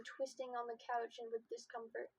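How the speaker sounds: usual speaking voice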